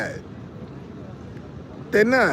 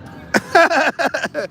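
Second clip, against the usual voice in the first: high laugh; 11 semitones higher than usual. Real, not acted. Laughing at a question.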